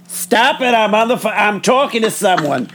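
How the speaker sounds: hammed-up grandmother interrupted on the phone; grandma scolding. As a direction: shoo the kids away mid-call like a harried grandmother. Acting grandma scolding kids on phone.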